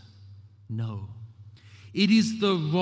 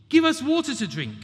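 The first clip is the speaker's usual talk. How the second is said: high, loud complaining demand; grumbling demand; 10 semitones higher, 6 dB louder than usual. Acted. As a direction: bark it like a thirsty, fed-up crowd. Grumbling people demand water.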